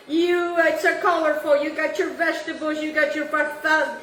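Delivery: usual speaking voice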